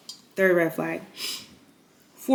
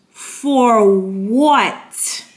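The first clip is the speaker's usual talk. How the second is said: stunned outburst; 2 semitones higher, 8 dB louder than usual. Retold or imitated. Shocked at police threat.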